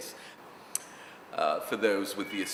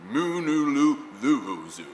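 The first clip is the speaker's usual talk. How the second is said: higher, measured vocal-exercise syllables; elocution drill; 4 semitones higher, 4 dB louder than usual. Retold or imitated. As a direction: intone it like a diction exercise.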